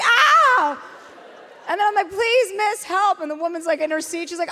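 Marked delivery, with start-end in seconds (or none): 0.0-0.8 very high, loud yelp of pain. Retold or imitated. Rope burn from the leash. 2.1-3.2 high, pleading appeal. Retold or imitated. Begging a passenger for help.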